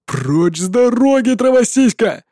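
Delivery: slow snarling creature voice; growled. Acted. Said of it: "прорычала Кора".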